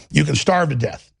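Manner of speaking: ordinary delivery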